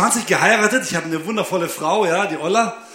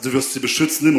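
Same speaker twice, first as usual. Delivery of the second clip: lower, solemn father-in-law; 7 semitones lower than usual. Retold or imitated. Father-in-law charges groom at the altar, lower and solemn. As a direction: intone it like a vow at the altar. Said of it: "mein Schwiegervater am Altar stand und gesagt hat".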